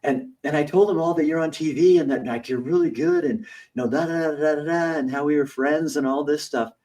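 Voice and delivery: high, eager fake-friendly voice; mock schoolmate all excited to see you; gushing old classmate. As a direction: gush over the TV star. Old bully gushing at grocery store.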